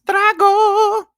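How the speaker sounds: sudden high, loud cry of drago; playful dragon call; dramatic exclaim. Shown as a demonstration. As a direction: shout the word like a battle call. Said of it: "dramatic repeat of the word".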